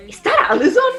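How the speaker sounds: surprised question. Retold or imitated. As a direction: ask it amazed. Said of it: "she was like".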